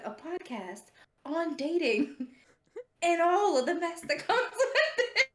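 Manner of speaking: words rising high through real laughter; helpless giggling, pitch climbing; laughing. Real, not acted. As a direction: crack up as you finish the sentence. Cracks up while retelling.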